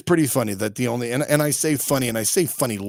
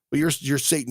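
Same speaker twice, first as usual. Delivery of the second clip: ordinary talk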